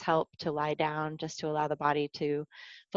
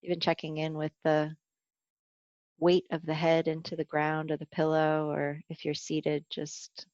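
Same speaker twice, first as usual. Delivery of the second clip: guided meditation. Acted. Teacher guiding a body scan for the class, calm meditation voice. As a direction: ease listeners down into the body, gently.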